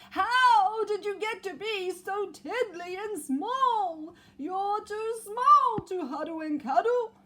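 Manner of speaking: high, slow, very loud bawl; bawling giant bear. Acted. Giant bear wailing over its tiny teddy, loud bawl. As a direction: wail it out like a huge crying bear. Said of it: "the voice bawl".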